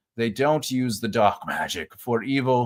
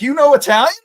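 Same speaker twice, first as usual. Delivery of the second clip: high, loud incredulous question; mock surprise; 13 semitones higher, 9 dB louder, faster than usual. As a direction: imitate someone astonished, pitch leaping up. Imitates brothers' mock-surprised comeback.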